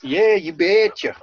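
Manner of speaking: slow, hammed-up Minnesota accent. Minnesotan retelling his East Coast trip, slow mock Minnesota accent. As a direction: lean into the Minnesota accent with a folksy "you betcha". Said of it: "You can notice our dialect".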